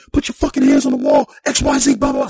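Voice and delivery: loud, low barked commands; retold cop scream. Cop screaming to put hands on the wall, loud low shout. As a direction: bellow it at the wall.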